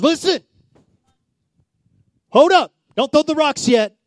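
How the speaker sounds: loud, high call to stop; urgent command. Acted. Jesus halting the crowd with a loud, high shout. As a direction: shout them down before they throw.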